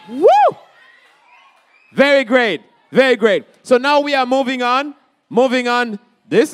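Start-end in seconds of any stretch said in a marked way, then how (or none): 0.1-0.6 hype cry. Real, not acted. Hyping the crowd.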